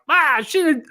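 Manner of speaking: high, loud put-on accent mocking fans; squeaky Larry David-ish mock fan voice. Co-host mocking fans in a put-on accent, high and loud. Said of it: "why I'm doing this accent".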